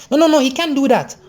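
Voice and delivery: high-pitched outraged refusal; scandalized friend objecting; indignant protest. Retold or imitated. Friend protests loudly, told to lower voice.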